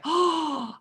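high, startled "oh"; retold shocked gasp. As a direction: gasp as if it just hit you. Sudden realisation of the bag, retold.

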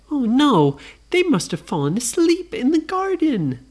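high-pitched mock alarm; hammed-up little-girl realization; dismayed Nora. Nora realizes brothers slept.

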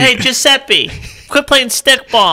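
high, loud hammed-up old-world shout; mock Italian neighbor. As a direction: yell at the kid from the window, playfully.

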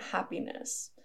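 breath caught by a hiccup. Real, not acted. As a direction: say it through a hiccup. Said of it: "I have the hiccups a little bit".

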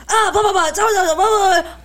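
high, gibbering imitation of shock; mock babbling freak-out. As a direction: babble wildly as if overwhelmed. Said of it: "she'll be like".

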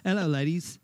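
put-on British greeting; mock-British, performed for friends; fake British accent. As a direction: try out a playful British accent. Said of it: "with a fake British accent".

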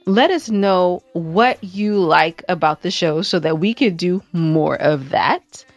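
usual speaking voice

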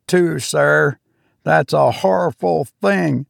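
high triumphant steward. Acted. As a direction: crow it like a steward proved right. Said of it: "continued Robert triumphantly".

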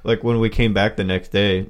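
normal talking voice

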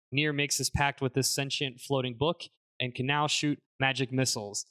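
ordinary talk, nothing marked

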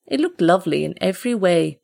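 usual speaking voice

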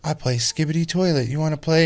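put-on high excited kid voice; imitating little kid. Dad mimicking the kid asking to play, in a high kid voice. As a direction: chirp it like an eager little boy. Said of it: "he's just like".

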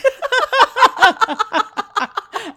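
big shrieking laugh; loud high cackle. Real, not acted. Host cracks up at the boob joke with a cackle. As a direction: burst out cackling.

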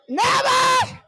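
high loud cry of never; shouted never. Real, not acted. Preacher shouting to the congregation. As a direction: hurl the word out.

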